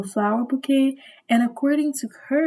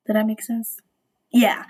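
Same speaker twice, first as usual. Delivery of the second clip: normal talking voice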